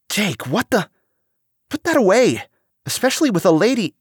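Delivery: high-pitched, startled scolding; alarmed protest. A narrator's reading. Louis sees his brother's dagger, high alarmed protest. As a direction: protest in shock, pitch shooting way up.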